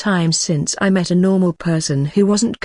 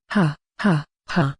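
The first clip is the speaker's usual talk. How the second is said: laughter barely held in; still laughing; 2 semitones lower, 3 dB quieter than usual. Acted. Orc still laughing after teasing the nervous elf. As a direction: keep laughing through the words. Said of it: "It's hard to talk while laughing".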